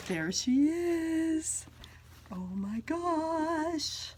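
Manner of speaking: slow, high, breathless awe; awed. Real, not acted. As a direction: marvel at it slowly. First sight of the bag.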